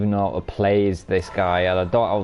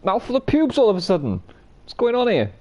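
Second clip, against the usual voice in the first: much higher incredulous voice; squeaky disgust; 11 semitones higher than usual. Real, not acted. Streamer finding a hair in his mouth, high disgusted. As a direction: squeak it out in mock horror.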